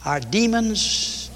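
ordinary talk, nothing marked

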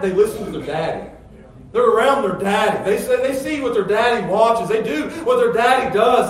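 high, loud, rousing pulpit delivery; impassioned preaching. Real, not acted. Preacher boasting that his boys follow their daddy, impassioned preaching. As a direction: build to a proud, emphatic shout.

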